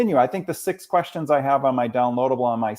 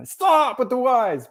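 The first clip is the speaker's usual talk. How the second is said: imitated exasperation; 12 semitones higher, 4 dB louder, slower than usual. Retold student fed up with questions.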